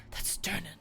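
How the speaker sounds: hushed unvoiced whisper. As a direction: whisper it in awe. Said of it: "And they're like".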